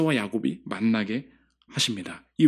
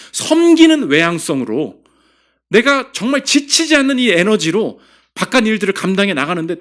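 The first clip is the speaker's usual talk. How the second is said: higher and louder, fervent sermon climax; full-force preaching; 8 semitones higher, 7 dB louder than usual. Acted. Pastor urging the congregation about Jesus, full-force preaching. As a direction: preach it out with rising fire.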